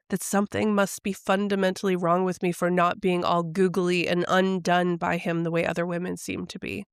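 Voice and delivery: ordinary delivery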